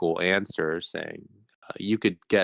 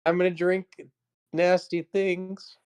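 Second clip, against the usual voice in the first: soft anchorman voice; 11 semitones higher than usual. Shown as a demonstration. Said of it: "a soft voice to say".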